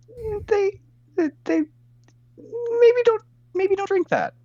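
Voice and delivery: high squeaky voice. Acted. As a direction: squeak it out through a waxy mouth. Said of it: "I'm just like".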